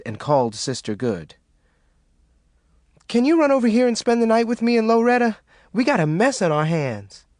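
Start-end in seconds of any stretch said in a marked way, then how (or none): 3.1-7.2 urgent. Acted. Urgent call for help.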